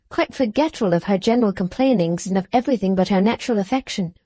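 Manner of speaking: plain reading voice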